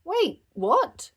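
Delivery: high loud startled cry; surprised shout. Acted. Bluey as Dad stops the train, surprised shout. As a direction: shout in disbelief. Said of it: "shouted Bluey".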